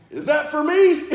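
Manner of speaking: full-volume, high-pitched drill sergeant bellow; drill instructor yell. Retold or imitated. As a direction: yell it at a recruit, drawn out. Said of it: "Everything he says is a yell".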